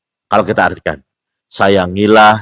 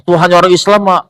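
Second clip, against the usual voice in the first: mocking quote; 9 semitones higher than usual. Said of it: "arguing person quoted".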